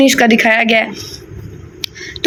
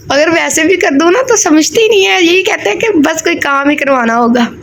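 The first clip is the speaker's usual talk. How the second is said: imitating mom; 4 semitones higher than usual. Imitating her mother's complaint.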